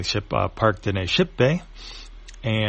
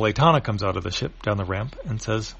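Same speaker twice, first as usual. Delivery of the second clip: ordinary delivery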